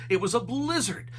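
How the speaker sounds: ordinary delivery, nothing marked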